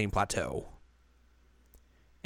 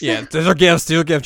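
higher and louder, nervous insistence; flustered high voice; 6 semitones higher, 9 dB louder than usual. Retold or imitated. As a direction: insist it's gifts, flustered. Echoing Riko's excuse.

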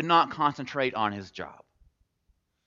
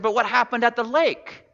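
high, louder puzzled query; incredulous friend, pitched up and louder; baffled question; 9 semitones higher, 6 dB louder than usual. Acted. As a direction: press for an answer, voice rising high. Frog presses the jilted mouse.

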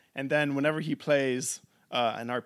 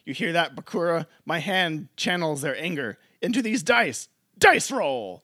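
higher, louder heroic anime call; 4 semitones higher, 5 dB louder than usual. Retold or imitated. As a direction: proclaim like a shonen hero. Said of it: "he's like".

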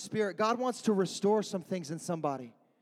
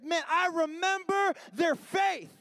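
slow, higher, swelling call; building shout; 7 semitones higher, slower than usual. Acted. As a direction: ring it out to the back row. Preacher crescendo on repeated line.

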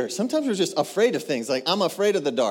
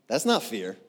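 ordinary delivery

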